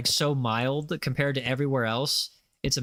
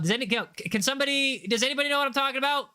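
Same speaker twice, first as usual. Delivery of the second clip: high, fast, eager appeal; calling out to chat; 9 semitones higher, faster than usual. Real, not acted. Streamer begging chat to name the country, excited and high. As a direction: pitch it up and rush it out.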